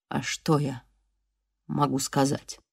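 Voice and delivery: low, soft, dull wounded man; lightly voiced weak injured man; dull, hollow voice. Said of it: "Его голос звучал глухо". (глухим, низким голосом)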